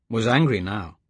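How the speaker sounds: ordinary delivery, nothing marked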